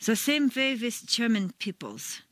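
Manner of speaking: stiff German-accented English. Shown as a demonstration. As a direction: imitate a stiff German speaker. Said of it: "It sounds too stiff".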